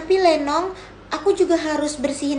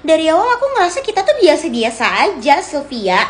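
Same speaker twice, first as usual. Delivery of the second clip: normal talking voice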